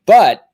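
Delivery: BUT punched high and loud; emphatic condition. Retold or imitated. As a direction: hit BUT hard as the catch, then leave it hanging. Mom's emphatic catch in retold call.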